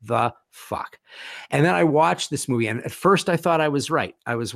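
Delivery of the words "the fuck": slow, word by word; drawn-out, baffled reaction; stunned disbelief. Retold or imitated. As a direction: spell out the disbelief one word at a time. Reacting to the film's preview.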